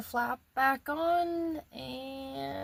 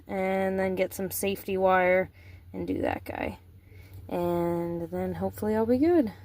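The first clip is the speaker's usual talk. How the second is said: ordinary talk, nothing marked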